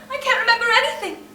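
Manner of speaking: fast, loud, tumbling despair; frantic despair. Acted. Irina losing her grip before her sisters, fast loud despair.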